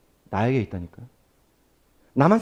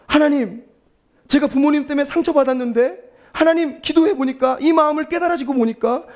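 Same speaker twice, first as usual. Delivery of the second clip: put-on a young believer praying aloud, pitched higher and heartfelt; earnest prayer voice; 7 semitones higher than usual. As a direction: pour it out to God like a confession.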